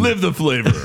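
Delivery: laughing ad tagline read; slogan voice. Pretend. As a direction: sell it like a commercial slogan. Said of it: "That's a slogan".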